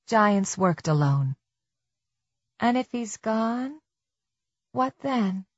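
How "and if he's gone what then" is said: high, soft self-questioning; questioning inner voice. A narrator's reading. Tess wondering what comes next, high soft inner voice. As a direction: ask yourself in a raised hush.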